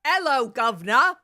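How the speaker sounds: mock Cockney greeting, pushed out loud; a cheery London street hello; British accent. Player tries a British accent on the greeting. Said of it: "how to do a British accent".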